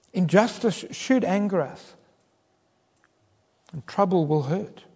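usual speaking voice

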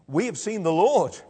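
high, loud burst of news; excited announcement. Acted. Disciples telling Thomas they have seen the Lord, excited. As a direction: proclaim it with excitement.

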